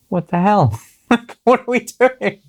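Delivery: high, incredulous cry of frustration, half laughing; exasperated outburst. Retold or imitated. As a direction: let the pitch fly up in fed-up disbelief. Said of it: "I'm just pissed off".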